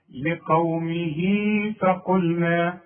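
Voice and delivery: لقومه فقلنا drawn out slowly, vowel of هاء short; slow Quranic recitation. Shown as a demonstration. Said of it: "تقول". (تلاوة بطيئة لقومه فقلنا)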